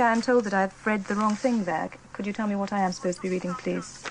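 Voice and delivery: ordinary talk, nothing marked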